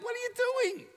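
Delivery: very high, strained inner protest; exasperated. Retold or imitated. Passenger scolding himself for chatting, high exasperation. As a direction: squeal in mock despair at yourself.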